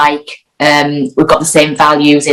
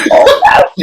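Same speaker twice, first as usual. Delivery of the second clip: squeaky high oh no of horror; a sudden high yelp of dismay; retold panic. Caught in a stranger's bedroom, high panicked cry. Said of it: "I was like".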